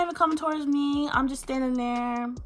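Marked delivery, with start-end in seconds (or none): none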